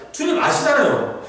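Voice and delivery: 주님 thrown high with a laugh, falling 아시잖아요; half-laughing high plea on 주님; pleading with God. Retold or imitated. Job pleads with God, laughing high plea. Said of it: "계속 그거 하는 거예요".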